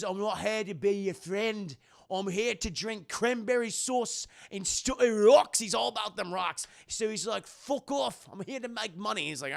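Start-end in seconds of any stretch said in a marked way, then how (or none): 0.0-5.6 hammed-up surly Scottish brogue; mock Scottish accent. Geologist refusing to be friends, mock Scottish accent. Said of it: "he's very Scottish". 7.5-9.3 high-pitched surly Scottish brogue; mock Scottish accent. Geologist telling biologist to get lost, mock Scottish accent. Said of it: "he's very Scottish".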